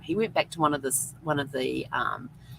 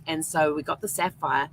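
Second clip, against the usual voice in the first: ordinary talk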